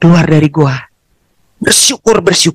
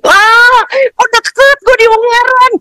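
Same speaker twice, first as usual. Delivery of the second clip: delighted shock; slower than usual. Retold or imitated. Mother learning her son is nearby, high joyful squeal. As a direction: squeal that he's so close.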